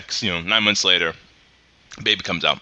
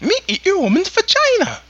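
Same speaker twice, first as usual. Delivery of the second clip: much higher, louder goofy alien impression; crude, squeaky Jar Jar Binks bit; 4 dB louder than usual. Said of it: "redo Jar Jar Binks".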